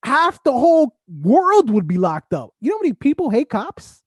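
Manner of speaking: incredulous outburst. Real, not acted. Incredulous rant about hate crime.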